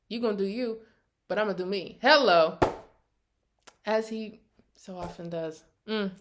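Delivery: usual speaking voice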